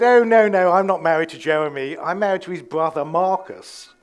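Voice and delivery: loud posh English protest, rattled off; posh English accent. Retold or imitated. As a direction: correct her in affected posh English. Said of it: "They don't speak like that normally".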